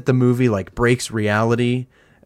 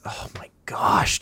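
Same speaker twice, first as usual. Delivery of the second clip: awed whisper; slower than usual. Real, not acted. Awed by the final stair scene.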